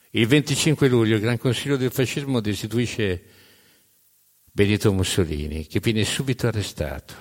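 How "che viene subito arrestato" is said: ordinary delivery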